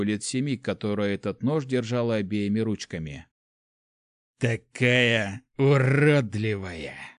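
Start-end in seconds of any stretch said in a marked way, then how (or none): 4.4-7.1 slow, drawn-out contempt; spitting it out. A narrator's reading. Stabbed prince to the little girl, spitting disgust. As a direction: spit the words out slowly. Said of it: "выплюнул первый принц".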